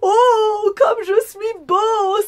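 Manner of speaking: very high, loud, long-drawn cry of self-admiration; hammed-up rapturous wolf; delighted exclamation. A narrator's reading. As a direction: crow with vain delight, stretching every vowel. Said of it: "et s'exclama".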